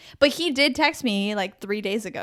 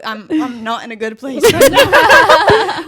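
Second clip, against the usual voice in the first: laughing retelling; 11 dB louder than usual. Real, not acted. Friend retelling her broken-tooth call, laughing as she pleads. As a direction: retell the plea through laughter, getting louder.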